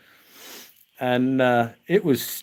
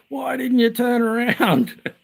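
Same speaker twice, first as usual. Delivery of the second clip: much higher, slow put-on scolding; arguing teacher; 10 semitones higher, slower than usual. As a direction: draw out the reproach in a mock row. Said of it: "they went at it".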